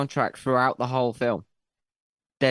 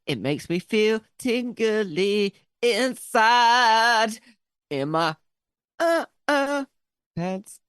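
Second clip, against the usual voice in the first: mock sultry; 7 semitones higher, much slower than usual. Jokey answer about feeling tingly.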